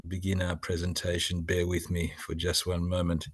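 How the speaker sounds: ordinary talk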